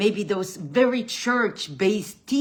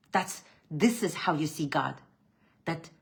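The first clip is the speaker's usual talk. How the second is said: ordinary talk, nothing marked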